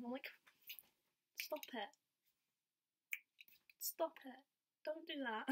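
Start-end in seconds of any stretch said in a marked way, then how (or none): 1.4-5.5 mock protest, higher; flustered teasing refusal; playful protest.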